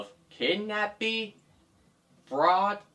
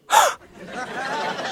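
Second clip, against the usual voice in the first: very high airy gasp; high startled gasp; 3 dB louder than usual. Acted. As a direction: gasp in shock. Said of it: "she gasped once again".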